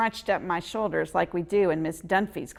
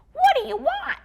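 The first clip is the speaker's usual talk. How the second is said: high, loud squeaky little squirrel; 8 dB louder than usual. Acted. Squirrel snapping at a staring boy, squeaky and loud. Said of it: "he asked in a squeaky voice".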